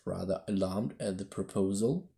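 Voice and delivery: ordinary delivery, nothing marked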